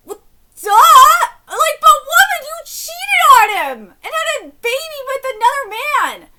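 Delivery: high, loud, breaking shout; yelling at the cheating wife; furious yell. Real, not acted. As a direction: scream at her in outrage.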